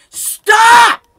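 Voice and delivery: very loud, high outburst; exasperated shout. Real, not acted. Player fed up with game updates, exasperated scream. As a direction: scream it in frustration. Ranting about the game's weapons.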